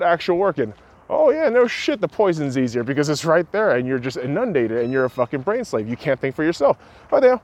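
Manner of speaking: high, loud sneering rant; sarcastic tirade. Real, not acted. Berating fast food eaters, with high loud sarcasm.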